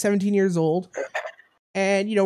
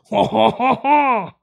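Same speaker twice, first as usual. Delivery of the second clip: mock orgasm laugh; 5 dB louder than usual. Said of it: "they sound like".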